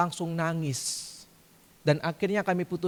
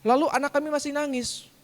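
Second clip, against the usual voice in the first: normal talking voice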